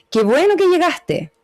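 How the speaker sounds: high, unhurried "Qué bueno que llegaste", a touch restrained; glad but formal. Shown as a demonstration. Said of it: "Contenta, pero en un sentido un poquito más formal".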